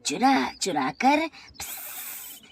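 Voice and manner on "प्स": slow drawn-out hiss with no voice; long snake hiss. Acted. The snake hisses slowly while accusing Hari, long hiss. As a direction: let the hiss stretch out like a snake.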